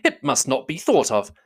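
high, urgent objection; emphatic protest. A narrator's reading. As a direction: cry out in protest. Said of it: "cried Bingley".

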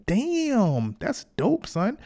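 high, excited praise for work done really well; impressed hype. Retold or imitated. Praising his own best work, high and excited. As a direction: gush over it like a proud hype man. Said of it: "so my best is gonna be like".

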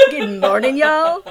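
rising, laughing sing-song greeting; mock cheerful morning greeting; imitating a student's greeting. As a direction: chirp it through a laugh. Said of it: "he goes".

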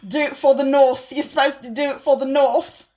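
hammed-up rallying cry for the North; light Jon Snow impression; Jon Snow accent. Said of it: "Jon Snow would say".

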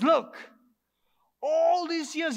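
sharp, loud protest at the father; angry complaint. Acted. Elder son snapping at his father, loud and aggrieved. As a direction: throw it at him in resentment. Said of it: "he complains to his father".

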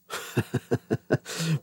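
soft, high chuckle. Real, not acted. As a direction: laugh quietly at your own quip. Laughing at his rain remark.

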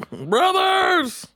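very high, loud hailing cry; mock joyful shout. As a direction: call out across town to long-lost kin.

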